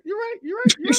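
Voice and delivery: high-pitched mock surrender; mock concession. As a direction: concede in a silly high squeak.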